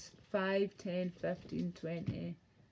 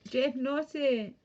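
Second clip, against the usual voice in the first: ordinary talk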